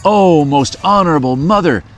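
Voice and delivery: urgent cry. Acted. Child cries to mother.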